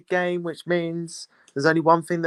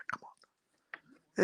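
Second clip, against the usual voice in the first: breathy hushed plea under the breath; will the team on in a whisper; tense whispered urging; whispered come on; 12 dB quieter than usual. Real, not acted.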